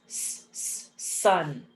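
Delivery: "s" hissed alone twice, then "sun"; sounded-out S. Shown as a demonstration. Teacher sounding out a word, separate "s, s, sun". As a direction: hiss each "s" separately before saying "sun". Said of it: "it makes the S sound".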